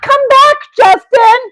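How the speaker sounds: high, pleading call after someone running off; calling after him. Acted. Calling a runaway Justin back from behind a bush.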